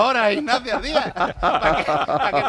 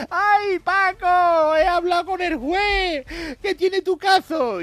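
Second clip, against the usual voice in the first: wailing wife; 11 semitones higher, 5 dB louder, much slower than usual. Acted. Joke wife voiced to husband.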